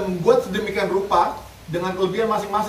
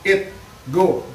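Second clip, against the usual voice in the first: dog command. Shown as a demonstration.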